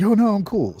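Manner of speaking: high, breezy buddy voice; friend's voice. Retold or imitated. Retold friend reassures him, high breezy voice.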